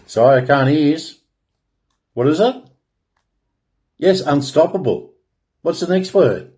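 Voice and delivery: calling on audience. Pretend. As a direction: pretend you can't hear them and coax an answer. Calls on audience to answer.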